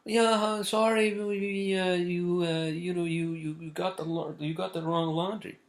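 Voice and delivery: high-pitched mock Spanish-accented lady; thick Spanish accent. Laundry lady phoning a customer about a mix-up, imitated Spanish accent. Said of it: "in her thick Spanish accent".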